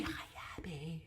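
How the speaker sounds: soft whispered hello. Real, not acted. Vlogger greeting a friend from the show, whispered. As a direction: follow the call with a hushed greeting.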